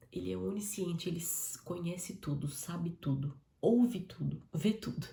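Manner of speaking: ordinary delivery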